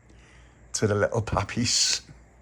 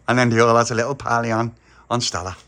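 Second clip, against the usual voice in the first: plain reading voice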